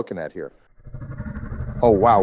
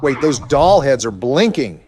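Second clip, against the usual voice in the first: startled; 6 semitones higher, 6 dB louder than usual. Real, not acted. Sudden alarm at blinking heads.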